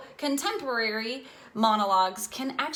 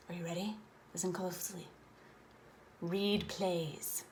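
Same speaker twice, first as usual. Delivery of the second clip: hushed, confiding tone, much softer; sharing a secret; 3 semitones lower, 11 dB quieter than usual. Shown as a demonstration. Teacher to acting students, revealing the big secret, hushed. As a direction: lean in and let them in on the secret. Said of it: "I'm gonna give you the secret".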